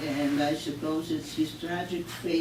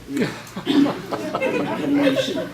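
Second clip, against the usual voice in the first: laughing; 10 semitones higher, 7 dB louder than usual. Real, not acted. Laughs at own joke.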